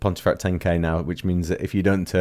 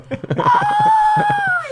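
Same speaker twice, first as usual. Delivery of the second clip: shrieking laugh; 3 dB louder than usual. Real, not acted. Reacting to a teasing jab.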